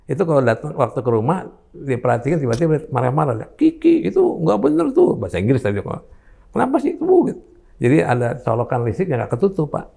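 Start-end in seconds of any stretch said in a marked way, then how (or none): 3.5-5.1 put-on high, slow little-child voice, fussing; toddler voice. Grandfather imitating his toddler grandchild, high child voice. 6.5-7.0 put-on toddler voice. Grandfather imitating his toddler grandchild, high child voice.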